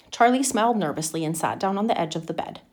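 usual speaking voice

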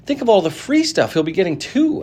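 put-on a jealous boy grumbling; lightly voiced envy; enviously. A narrator's reading. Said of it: "another boy said enviously".

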